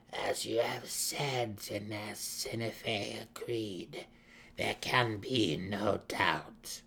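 put-on very slow, measured old matriarch; withered matron mother. A narrator's reading. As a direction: pronounce it slowly, with weighty authority.

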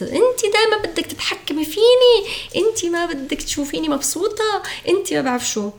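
put-on high-pitched protesting teenage girl; daughter lashing out. Teenage girl complaining to her mother that she controls her, high whiny mock protest. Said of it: "بنت تقول لأمها، صبية".

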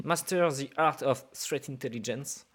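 put-on slow, careful English accent on the book title. Host reading the guest's book title in an English accent. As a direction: read the English title out in a deliberate English accent, slowly. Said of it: "avec mon accent anglais".

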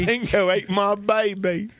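high, hammed-up Aussie wail; mock Australian, played for laughs; terrible Australian accent. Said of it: "My terrible Australian accent".

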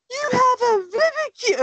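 gasp, then a high-pitched gushing squeal; retold delighted gasp. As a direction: re-enact being thrilled by a cute plush. Retold delighted reaction to plush toy.

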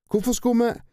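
a high, loud challenge; defiant. A narrator's reading. Tina defiantly challenging the kidnappers. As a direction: fling the question back.

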